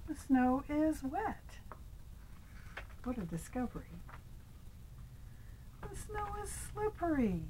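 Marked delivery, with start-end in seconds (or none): 6.0-7.4 high squeal. Acted. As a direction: voice jumps way up on "snow". Cat slips in the story.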